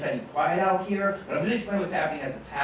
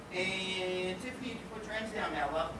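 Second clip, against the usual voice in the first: much quieter, slightly raised pitch; soft aside; 13 dB quieter than usual. Real, not acted. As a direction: drop right down to a gentle, hushed instruction. Soft aside to volunteer Tiffany.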